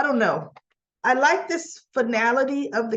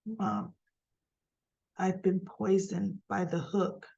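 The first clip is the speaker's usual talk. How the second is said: weak dying voice; 7 dB quieter than usual. Retold or imitated. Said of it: "she's like".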